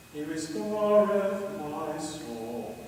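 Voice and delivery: "He restoreth my soul" drawn out very slowly; slow read. Shown as a demonstration. Conductor drawing out the short phrase for the choir, very slowly. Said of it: "a very short little phrase".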